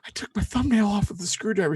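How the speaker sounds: blurting the bad news in pain; retold pained exclaim. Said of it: "I was like".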